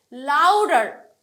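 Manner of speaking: Louder drawn out and loud. Shown as a demonstration. Teacher repeating her stressed word for students, louder loud. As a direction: punch louder, then drop back. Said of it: "louder ওয়ার্ডটাই তো আমি একটু loud করে বললাম".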